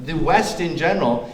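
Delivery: normal talking voice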